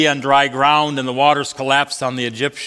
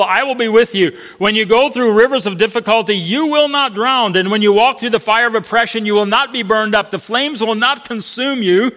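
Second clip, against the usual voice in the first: raised preaching voice proclaiming God's promise; preaching at full force; 6 semitones higher than usual. Acted.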